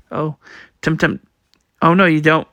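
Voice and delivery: ordinary delivery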